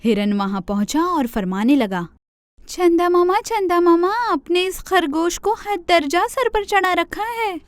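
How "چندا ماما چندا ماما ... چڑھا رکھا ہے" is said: much higher pleading deer voice; complaining deer. Acted. Deer complains to Chanda Mama.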